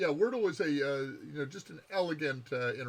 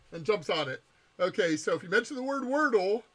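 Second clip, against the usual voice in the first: usual speaking voice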